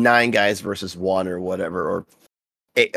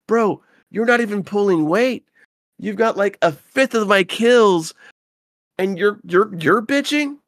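higher, exasperated mock retort to a teammate; incredulous retort; 11 semitones higher than usual. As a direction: talk down to a bottom-of-the-chart player.

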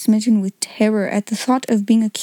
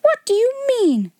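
much higher, rattled question; alarmed demand; 13 semitones higher than usual. Acted. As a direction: demand it as the ground slips away. Headmistress hears shocking news.

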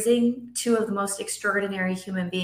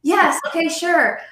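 higher, louder sing-song parent voice; mock parent offering; 8 semitones higher, 5 dB louder than usual. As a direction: act out an overly accommodating mom. Mocking parent offers kids juice.

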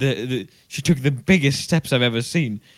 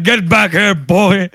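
high, loud yell; teacher's yell; 8 semitones higher, 10 dB louder, slower than usual. Retold or imitated. Teacher yelling at the boy, high loud shout. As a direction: roar it after the fleeing boy. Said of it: "And it was like".

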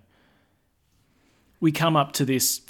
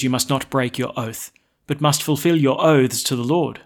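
loud, solemn scripture reading; gospel proclamation; 6 dB louder than usual. Acted. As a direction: proclaim it to the whole church. Priest proclaims gospel aloud.

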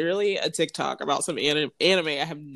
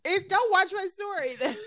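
laughing retort; 13 semitones higher than usual. Retold or imitated. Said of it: "And I was like".

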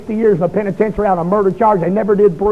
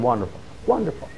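much lower and softer, deadpan; sarcastic mutter; 7 semitones lower, 12 dB quieter than usual. Retold or imitated. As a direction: mutter it under your breath, fed up. Dismayed the caller is his aunt.

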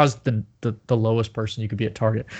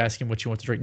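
normal talking voice

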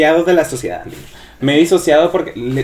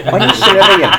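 higher, louder, laughing call; playful pretend greeting; 6 semitones higher, 7 dB louder than usual. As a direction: call out to an imaginary friend, cracking up. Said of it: "Yo me imaginé platicando con un chile relleno". (saludo fingido entre risas)

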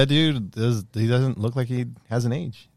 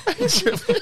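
high laughing echo. Real, not acted. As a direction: laugh through the words. Laughing repeat.

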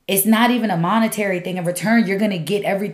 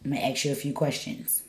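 ordinary talk, nothing marked

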